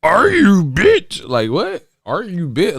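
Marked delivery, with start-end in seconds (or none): 0.0-1.3 high, loud mock creature voice; Yoda. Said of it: "they talking like Yoda and shit". 2.0-2.8 high mock creature voice; Yoda. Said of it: "these motherfuckers talking like Yoda".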